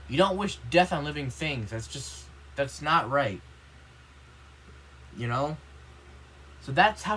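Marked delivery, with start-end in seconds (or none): none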